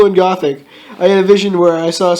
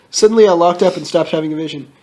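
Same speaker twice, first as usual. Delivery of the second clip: plain reading voice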